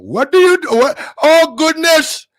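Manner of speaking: high, loud, drawn-out mock Indian accent; put-on Indian accent. Streamer practicing his accent for chat, high loud Indian accent. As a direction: exclaim in an exaggerated Indian accent. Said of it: "I gotta hang around some Indians".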